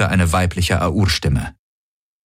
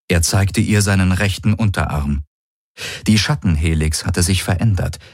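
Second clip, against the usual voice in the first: ordinary delivery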